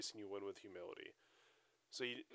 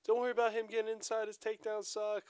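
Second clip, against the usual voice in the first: annoying rival coach; 11 semitones higher, 4 dB louder than usual. Retold or imitated. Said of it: "that is actually pretty fucking annoying".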